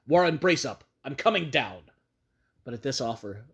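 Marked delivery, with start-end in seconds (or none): 0.1-1.9 loud determined call; shouted resolve. Acted. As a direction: shout down to rally him. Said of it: "I formed and shouted a resolution".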